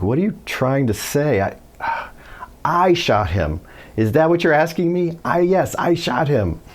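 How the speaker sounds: imitated incredulous suspect, pitched high; exasperated mock disbelief, high and emphatic; confused suspect. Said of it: "he looked confused".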